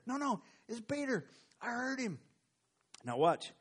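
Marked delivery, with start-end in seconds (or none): 0.1-2.2 high, pleading insistence; won't be talked out of it; insisting. Acted. Rhoda insisting to the crowd, high and pleading.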